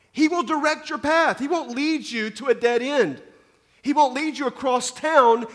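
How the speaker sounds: loud, high, urgent sermon voice; fervent preaching. Acted. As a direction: preach it out with full force. Preaching at full force.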